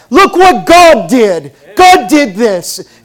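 slow, loud, proclaiming; preaching emphasis. Real, not acted. Pastor proclaiming to congregation, slow and loud. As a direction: proclaim it slowly to the church.

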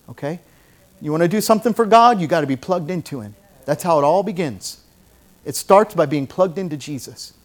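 usual speaking voice

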